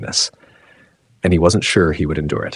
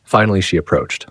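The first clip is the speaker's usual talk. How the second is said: ordinary delivery, nothing marked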